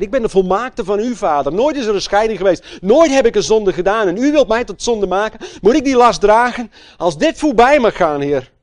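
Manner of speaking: loud, high desperate prayer to the Father; Jesus pleading loudly in Gethsemane; anguished plea. Acted.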